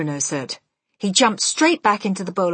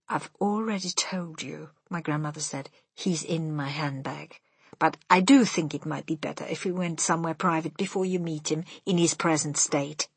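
quiet and patient; 2 semitones lower, 5 dB quieter than usual. Acted.

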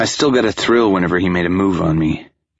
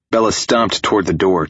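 plain reading voice

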